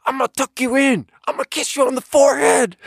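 high, strained rapper yell of tender words. Retold or imitated. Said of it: "the weirdest, softest stuff in the most aggressive way".

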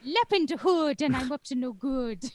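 high, loud cartoon Irish brogue; mock Irish accent. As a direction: chant it as a hammed-up Irish leprechaun. Joins in the bad Irish mockery.

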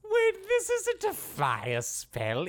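high, slow, drawn-out dismay; stunned realisation. Acted. Wizard finding his spell is wrong, dismayed. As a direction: exclaim as the mistake dawns.

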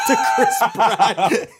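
laughing through the words; giggling it out. Real, not acted. Laughing while telling.

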